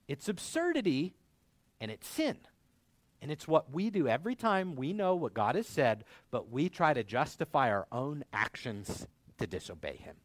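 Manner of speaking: ordinary talk, nothing marked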